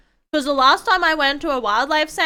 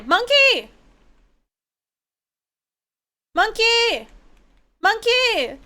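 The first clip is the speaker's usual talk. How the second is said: calling out; 10 semitones higher, much slower than usual. Real, not acted. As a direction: yell after the monkey as it runs. Calling out at the monkey.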